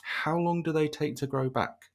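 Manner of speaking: imitating a wife, high and slow; mock wife voice, slow high-pitched question; retold wife's reaction. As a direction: pitch it up and draw out the question like a dismayed partner. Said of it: "first thing she said to me was".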